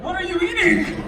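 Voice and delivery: high-pitched, incredulous question; mock astonishment. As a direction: ask it in exaggerated amazement. Acting out amazement at buff mother.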